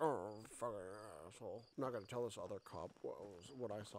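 low, soft mock grumbling; pissed-off cop imitation. Imitating the cop who won't tell the other, mock grumbling. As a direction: imitate a sore cop muttering to himself. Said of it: "He's all pissed over there. He's like".

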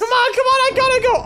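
high, loud panicked urging; frantic gamer fleeing danger; panicking gamer. Real, not acted. Running from the wither.

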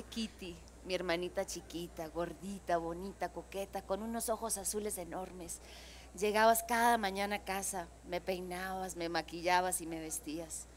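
hushed, wounded Frida monologue; quiet hurt accusation; Frida reproaching sister. Acted. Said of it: "Nos gustaría hacerles un pedacito de eso".

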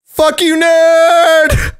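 loud, mocking high yell; taunting yell. Real, not acted. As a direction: shout the insult gleefully. Taunting after escaping.

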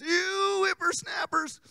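put-on high, slow creaky old man; old grandpa voice. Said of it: "Old Man Jenkins".

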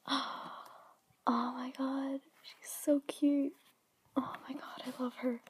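breathless awed whisper. Real, not acted. Whispering to herself over the toy, awed hush. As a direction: hush it out in wonder.

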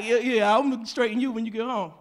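low-pitched mock threat; low imitated warning. Man promising to straighten her out at home, low mock warning.

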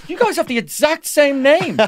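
high, slow, wide-eyed wonder; mock amazement. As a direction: marvel like a whole camp of kids. Everyone at camp marvelling.

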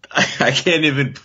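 can barely get the words out for laughing; laughing while talking. Real, not acted. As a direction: talk through real laughter. Laughs while admitting dislike.

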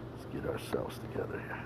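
barely audible, unvoiced aside; very soft murmur to oneself; whispered to self. Real, not acted. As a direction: whisper it privately while getting set. Muttering to self while fixing gear.

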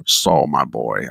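low, slow fatherly drawl; slow and deep like a kindly old Disney man; old animator's voice. Retold or imitated. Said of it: "I loved his voice. He had this cool voice".

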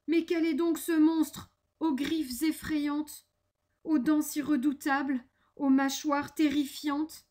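lightly voiced frightened describer; alarmed mouse. The mouse discovers the monster's terrifying features, mildly alarmed.

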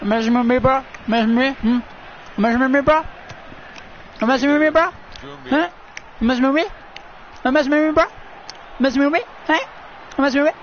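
high, repetitive goading; high-pitched mock challenge; mock taunting. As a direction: needle the opponent in short bursts. Taunts opponent in repeated bursts.

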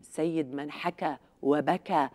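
ordinary talk

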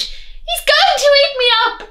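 high, loud terrified granny cry; cried out. Acted. Grandma terrified of the wolf, high frightened cry. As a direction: squeal like a frightened little granny. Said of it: "she cried".

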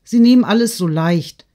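put-on high, loud girlish insistence; worked-up daughter; agitated protest. A narrator's reading. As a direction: protest urgently, pitched well up. Agitated daughter protesting to her mother.

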